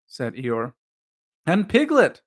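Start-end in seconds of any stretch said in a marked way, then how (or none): 1.5-2.2 high, louder eager exclamation; bursting with glee; excitedly. A narrator's reading. Christopher Robin names Piglet, high and excited. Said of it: "said Christopher Robin excitedly".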